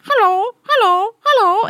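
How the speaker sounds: high squawky parrot hello; high parrot imitation, each hello chirped. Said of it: "was like".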